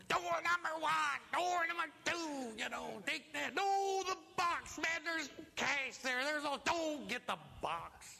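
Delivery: hollering advice at a contestant; mock crowd yelling. Game show audience shouting at contestant, mock yell. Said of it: "the people are screaming".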